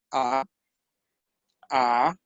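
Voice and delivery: "A" rising from low, as in a question; second tone. Shown as a demonstration. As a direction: glide "A" upward like asking something. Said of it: "la A en segundo tono".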